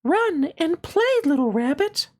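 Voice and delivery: very high, gentle fairy voice. Acted. Fairy greeting the little rabbit, high and gentle. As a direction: sing it out light and high like a fairy. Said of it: "she said".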